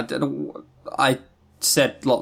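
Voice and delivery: ordinary talk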